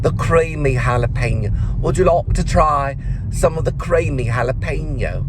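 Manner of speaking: put-on London accent with slight twang. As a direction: imitate her London accent with a faint Texas twang. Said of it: "maybe a little bit of Texas".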